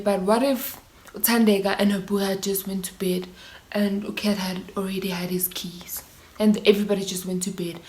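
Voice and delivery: whispered, voice dropping away; hushed what-if. Real, not acted. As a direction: whisper the thought as if it still scares you. Reflecting on near death.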